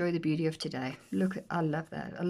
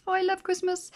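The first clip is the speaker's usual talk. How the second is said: squealed delight; 12 semitones higher, 3 dB louder than usual. Real, not acted. Repeat squealed high.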